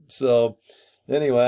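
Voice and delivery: ordinary talk, nothing marked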